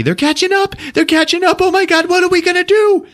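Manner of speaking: very high hysterical squeal; panicked character. Retold or imitated. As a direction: shriek in hammed-up panic. Grease Monkey panics.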